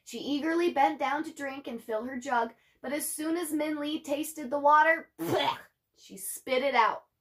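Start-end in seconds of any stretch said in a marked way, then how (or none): none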